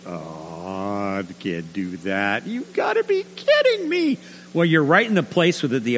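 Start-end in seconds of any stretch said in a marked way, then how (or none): 0.1-2.5 low, soft, slow groan; defeated groan. Acted. Listeners think about forgiving seven times, defeated. 2.5-4.2 very high, squeaky disbelief; incredulous. Acted. Listeners balk at forgiving so often, incredulous.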